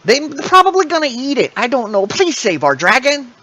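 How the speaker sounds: high pleading kobold queen. Acted. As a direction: beg them to save her baby.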